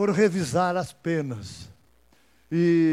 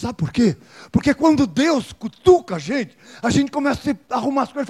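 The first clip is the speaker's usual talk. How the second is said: loud, high fervent declaration; preacher declaring God's prompting to the congregation, fervent; full-force preaching; 7 semitones higher, 9 dB louder than usual. Acted. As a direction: preach with conviction.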